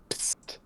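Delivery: quiet "psst" to get attention; soft attention-getting hiss from behind; a sneaky little psst. Acted. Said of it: "you hear behind you".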